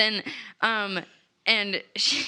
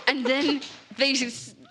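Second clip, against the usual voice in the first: ordinary talk, nothing marked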